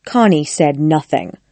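usual speaking voice

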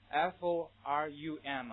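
slow letter-by-letter spelling. Shown as a demonstration. Teacher spelling the easily confused word, slow spelling. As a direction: spell it out slowly, one letter at a time. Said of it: "跟这样的一个单词弄混".

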